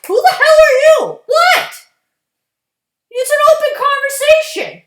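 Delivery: indignant phone talker. Pretend. Mocking a loud caller on the bus, high indignant outburst. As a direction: play the offended loudmouth on the phone.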